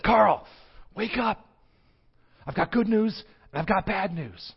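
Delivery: put-on a spirit waking his old buddy; high excited ghost voice; ghostly friend's voice. Dead friend Will calls Carl.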